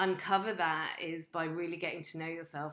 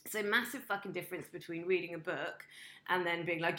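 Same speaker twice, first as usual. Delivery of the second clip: ordinary talk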